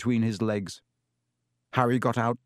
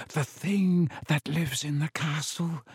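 put-on high, eerie old spider voice; ancient giant spider; 3 semitones higher than usual. A narrator's reading. Spider voice again.

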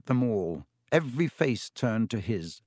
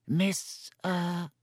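put-on higher, softer wheezy old teacher voice; dry, wheezy professor; 5 semitones higher, 4 dB quieter than usual. A narrator's reading. Ghost teacher interrupted mid-lecture by a student, dry and wheezy. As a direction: wheeze it out, hesitant and puzzled. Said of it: "he said in his dry, wheezy voice".

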